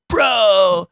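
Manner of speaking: high, drawn-out mock excitement; imitated thrilled bro. Bass player hit by a pellet to his friend, mock-thrilled. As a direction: stretch it out in an elated, high-pitched impression. Retold thrilled reaction to being shot.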